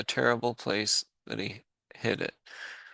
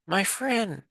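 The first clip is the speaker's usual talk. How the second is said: much higher small voice; tiny speck voice; 10 semitones higher than usual. Acted. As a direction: pipe it up like someone tiny. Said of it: "came the voice".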